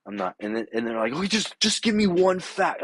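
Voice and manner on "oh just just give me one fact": high put-on whiny plea; mock friend plea. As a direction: pitch up and whine like the friend.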